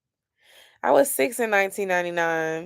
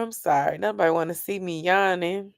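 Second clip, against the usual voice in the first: ordinary delivery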